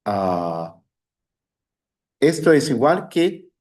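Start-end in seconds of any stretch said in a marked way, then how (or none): none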